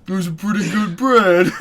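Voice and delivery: mock shout through laughs. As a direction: belt the line like a joke cashier. Mock cashier joke, laughing.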